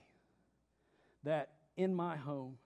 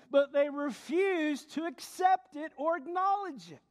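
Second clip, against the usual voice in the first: building from emphatic to high, ringing proclamation; full-force preaching; 11 semitones higher, 9 dB louder, slower than usual. Real, not acted. Preacher arguing only God should forgive sin, full-force preaching. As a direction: drive the argument home from the pulpit.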